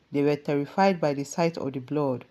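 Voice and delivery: ordinary delivery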